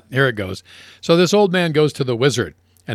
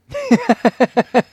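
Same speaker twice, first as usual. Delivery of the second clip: laughing; 11 semitones higher than usual. Real, not acted. Laughs at the jokes.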